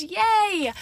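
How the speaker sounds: high, loud little cheer; excited cheer. Real, not acted. Teen on shopping trip for senior pictures, cheering. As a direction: let out a bright burst of celebration.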